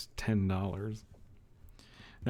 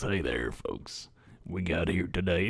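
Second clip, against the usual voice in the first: low southern folksy host voice; mock someone's claim with a deep southern accent; exaggerated southern accent; 4 semitones lower, 4 dB quieter than usual. Shown as a demonstration. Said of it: "you don't sound like this".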